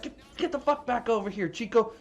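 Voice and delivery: high, calling out to the dog; calling the dog back. Retold or imitated. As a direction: holler for Chico to come back. Gang calls dog back.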